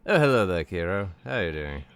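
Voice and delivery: ordinary delivery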